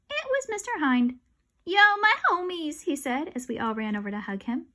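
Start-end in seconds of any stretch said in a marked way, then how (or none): 0.1-1.2 surprised reveal. A narrator's reading. 1.6-2.8 high, loud hip greeting; cool greeting. Acted. Cool guest greeting the kids, loud and high.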